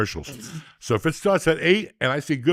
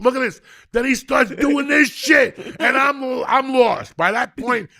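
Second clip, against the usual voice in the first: ecstatic rave; 8 semitones higher, 3 dB louder than usual. Real, not acted. Praising Pee-wee's bar dance to the room, ecstatic shouting. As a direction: rave like it's the greatest scene ever. Raving about favourite comedy scene.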